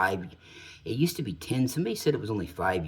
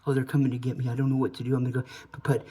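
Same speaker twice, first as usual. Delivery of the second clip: freaking out; 7 semitones higher, 3 dB louder, faster than usual. Retold or imitated. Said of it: "So he starts freaking out".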